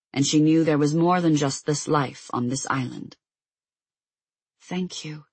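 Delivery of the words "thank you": soft whispered thank you. A narrator's reading. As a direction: breathe it out as a whisper. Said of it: "Diana whispered".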